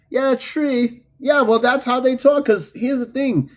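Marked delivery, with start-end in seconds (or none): 0.3-1.0 "tree" said with T, no TH; Guyanese TREE for three. Retold or imitated. Echoing the teacher's accent to viewers, TREE for three. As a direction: pitch "tree" up, T in place of TH. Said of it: "says tree instead of three".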